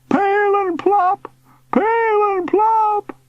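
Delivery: very high, stretched-out calls; distant shouting. A narrator's reading. Uncle sailing toward clouds, shouting. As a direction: shout from far up in the sky. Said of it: "Uncle Arvy shouted".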